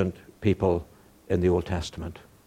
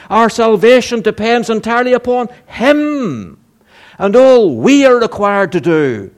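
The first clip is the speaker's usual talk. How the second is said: higher, louder, driving sermon voice; fervent preaching; 8 semitones higher, 5 dB louder than usual. Acted. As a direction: preach it with conviction to the flock. Preaching at full force.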